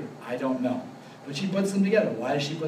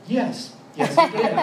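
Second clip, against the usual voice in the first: high-pitched, louder mock admission of nerves; mock nervous yes; 10 semitones higher, 5 dB louder than usual. Retelling the friend's admission at dinner, a high mock yelp. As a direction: squeak out the yes like a nervous preacher.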